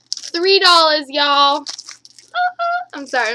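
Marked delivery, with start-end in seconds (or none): 0.1-1.6 louder, thrilled shout over the bargain; excited. Real, not acted. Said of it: "I'm so excited". 1.7-2.9 a high squeaky giggle. Real, not acted. Laughing at her own excitement.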